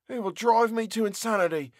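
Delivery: high, despairing outburst; anguished cry. Acted. Prisoner cries out alone in the vault, high and anguished. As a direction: cry out at the edge of madness. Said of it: "he cried".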